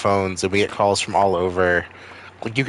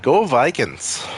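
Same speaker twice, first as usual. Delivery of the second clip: slow, higher mock Minnesota accent; Minnesota accent, kinda Swedish; 5 semitones higher, slower than usual. Imitating Minnesota callers cheering their team, singsong Minnesota accent. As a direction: stretch it out in a Swedish-tinged Minnesota lilt. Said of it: "They sound kinda Swedish".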